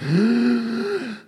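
long, high-pitched gasp; dramatic gasp. Pretend. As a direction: gasp for suspense before the big reveal. Builds up to naming favorite Doctor.

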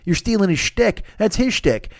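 ordinary delivery

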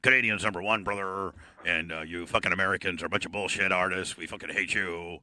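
low, heavy-breathing wrestler voice; Canadian wrestler defending his gimmick, low and breathy. Acted. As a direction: stay in the gruff promo character. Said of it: "we don't even know what you regularly sound like".

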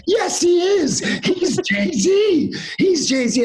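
frantic insisting. Retold or imitated. Friend losing his mind at the bouncer, shrill frantic insisting. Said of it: "losing his mind".

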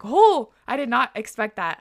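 loud, high re-enacted shock; shocked reaction. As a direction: act out your stunned first reaction. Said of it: "when I read that, I was like".

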